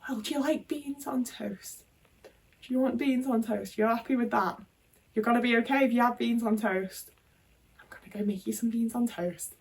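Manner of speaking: soft patient carer offering food to a difficult nan; gentle patient offer. Retold or imitated. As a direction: swallow the anger and coax her kindly. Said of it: "instead I was like".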